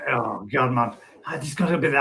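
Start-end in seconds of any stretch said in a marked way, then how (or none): none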